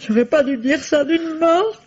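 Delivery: high, nervous half-laugh through the words; laughing nervously. Acted. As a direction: giggle guiltily as you fret.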